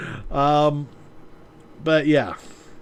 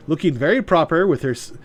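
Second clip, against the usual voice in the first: ordinary delivery, nothing marked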